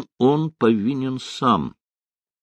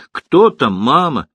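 lightly voiced child, higher and louder; child's voice; 4 semitones higher, 5 dB louder than usual. A narrator's reading. Little girl calling to her mother from another room, light child's voice. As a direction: call out like a small child, lightly done. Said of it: "послышался детский голос из комнаты".

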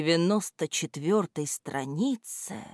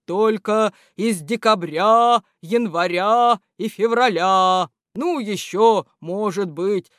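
slow, louder month-character voice; embarrassed January; 3 semitones higher, 5 dB louder, slower than usual. A narrator's reading. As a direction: confess sheepishly as the winter month. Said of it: "смущённо добавил январь".

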